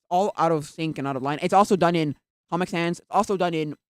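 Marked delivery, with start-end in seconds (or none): none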